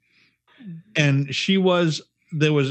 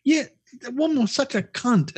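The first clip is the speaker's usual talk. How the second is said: snide inner voice; 7 semitones higher than usual. Retold or imitated. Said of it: "I was like".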